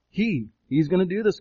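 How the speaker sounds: HE said alone, then repeated with emphasis; stress on HE. Shown as a demonstration. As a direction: lean hard on "He", setting it apart from the rest. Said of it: "He is emphasized in the original language".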